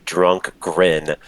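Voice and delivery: normal talking voice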